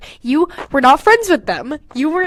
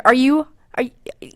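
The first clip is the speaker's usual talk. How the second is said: whisper. Real, not acted. Teasing aside.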